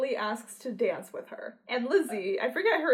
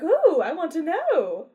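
eager Mary; 6 semitones higher, 8 dB louder, slower than usual. Retold or imitated. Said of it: "she's like".

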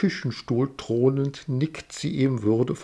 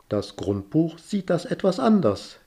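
pointed; 7 semitones higher than usual. Acted. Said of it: "erwidert sie spitz".

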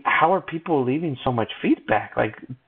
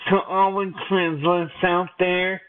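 high, slow celebrity declaration; high pitch; 5 semitones higher, slower than usual. Shown as a demonstration. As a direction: stretch it out, pitched up like a pop star. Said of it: "you have to give a real high pitch".

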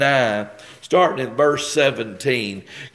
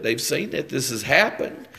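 ordinary talk

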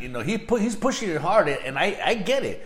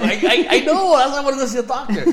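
laughing reply; 10 semitones higher, 7 dB louder, faster than usual. Retold or imitated. Retelling his reply to wife.

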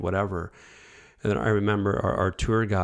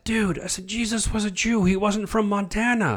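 excited; 10 semitones higher than usual. Retold or imitated. Telling friend the revelation.

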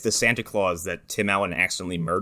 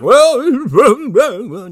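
magical Santa; 13 semitones higher, 7 dB louder than usual. Retold or imitated. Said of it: "starts talking magical bibble babble".

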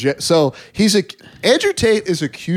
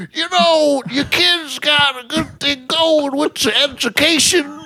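Bill Cosby impression; 8 semitones higher than usual. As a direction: drag it out like Cosby. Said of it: "him going".

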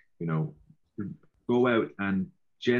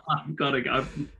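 laughing; 7 semitones higher, 4 dB louder than usual. Real, not acted. Repeats the joke while laughing.

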